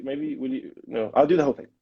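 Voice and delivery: usual speaking voice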